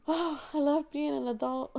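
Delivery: mock-blissful sigh of delight; gushing contentment. Acts out own contented gushing.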